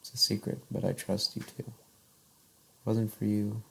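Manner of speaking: soft, fading poem recital; poem reading. Acted. Reads her poem aloud.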